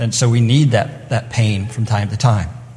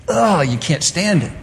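higher, slow groan of loathing; disgusted; 6 semitones higher than usual. Acted. Preacher showing what it means to totally abhor, disgusted. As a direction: recoil with a drawn-out "ugh". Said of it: "it means to totally abhor".

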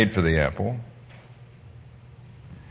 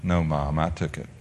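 quiet confession; 9 semitones lower, 10 dB quieter than usual. Retold or imitated.

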